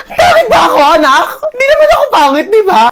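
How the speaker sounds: very high, hammed-up hurt wail; comic tearful protest; mock wailing. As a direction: wail in wounded vanity, pitch shooting up. Parent hurt at being called ugly.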